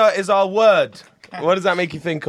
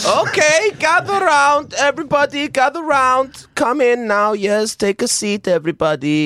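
Pope voice; 7 semitones higher than usual. Acted. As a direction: summon the flock, grandly. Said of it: "I'm the Pope".

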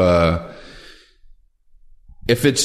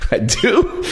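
high, laughing, startled little question; surprised; 11 semitones higher than usual. Retold or imitated. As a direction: act caught off guard and amused. Startled by the cameraman's complaint.

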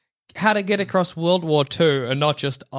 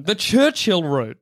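normal talking voice

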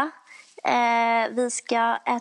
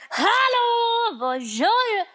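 mock shouted greeting; 11 semitones higher, much slower than usual. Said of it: "hellre någon som är så här".

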